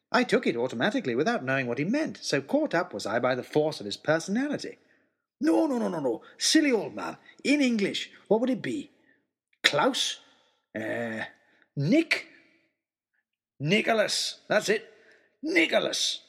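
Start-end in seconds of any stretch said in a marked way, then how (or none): 5.4-16.2 jolly big man. Acted. Giant recalls his name.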